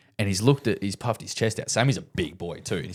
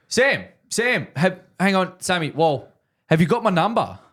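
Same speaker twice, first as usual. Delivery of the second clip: calling out; 9 semitones higher than usual. Retold or imitated. Golfer calling his mate back, raised voice. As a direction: shout after a mate, pitched way up.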